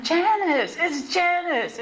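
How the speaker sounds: high excited call across the yard; calling out excitedly. Retold or imitated. Old inmates spotting her across the compound, high excited calling. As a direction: sing out the name like you just spotted an old friend.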